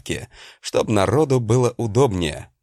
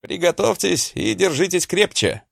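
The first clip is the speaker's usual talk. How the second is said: barking order; 8 semitones higher than usual. Acted. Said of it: "гаркнул главное наставление".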